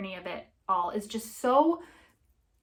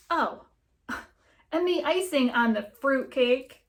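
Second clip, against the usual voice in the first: laughing; 4 semitones higher, 6 dB louder, slower than usual. Acted. Laugh heard mid-line.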